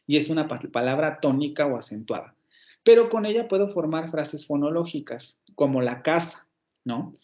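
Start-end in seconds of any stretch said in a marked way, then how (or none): none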